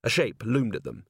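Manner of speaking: usual speaking voice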